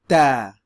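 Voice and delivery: ta with a clean t, no puff of air, said loud; ta said the right way, no breath; unaspirated ta. Shown as a demonstration. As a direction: model ta without aspiration. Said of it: "more clean pronunciation".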